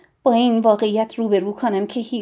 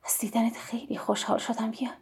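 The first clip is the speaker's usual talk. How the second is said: soft, hushed and gentle greeting; 9 dB quieter than usual. A narrator's reading. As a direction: drop to a soft, tender voice. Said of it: "با صدای ملایم گفت".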